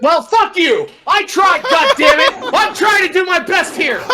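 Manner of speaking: high, loud, comic exasperated shouting; indignant outburst. Real, not acted. Reader whose accent was called the worst, shouting back indignantly. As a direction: yell back in wounded protest. Mocked for his bad accent.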